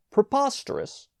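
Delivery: high outraged exclamation. A narrator's reading. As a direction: spit it out in disbelief. Brownell outraged at smoking.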